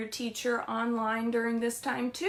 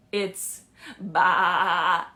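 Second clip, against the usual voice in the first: bleating baa; playful sheep bleat; 4 semitones lower, 6 dB louder than usual. Shown as a demonstration. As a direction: bleat it like a lamb. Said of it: "A sheep has its own special sound".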